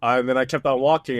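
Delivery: ordinary talk, nothing marked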